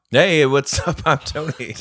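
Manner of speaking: high, loud hyped greeting; excited. Pretend. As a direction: pump it up like a host. Mock podcast intro.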